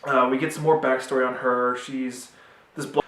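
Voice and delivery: usual speaking voice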